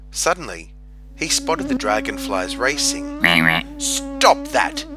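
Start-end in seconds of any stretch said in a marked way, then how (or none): 3.8-4.8 high, loud quacking order; quacking Duck. A narrator's reading. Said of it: "he quacked".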